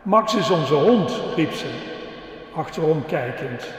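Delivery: put-on lively storytelling for the kids; reading to children. Preacher telling the children a dog story, lively. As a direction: tell it like a children's story with voices.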